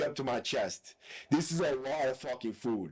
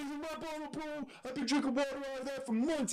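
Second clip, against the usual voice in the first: very high, soft imitation of the accused; hammed-up pitiful excuse; mock whining protest; 13 semitones higher, 4 dB quieter than usual. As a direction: whine it high and small, mocking him. Accused man protests about his bottle.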